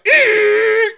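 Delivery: high, loud shriek; surprised squeal. Retold or imitated. Fan startled by sudden hug, high squeal. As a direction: squeal in shock. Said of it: "I was just like".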